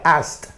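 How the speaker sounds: "asked" said as "ast", softly. Shown as a demonstration. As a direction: end "asked" on a voiceless t. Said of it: "lo pronuncio igual".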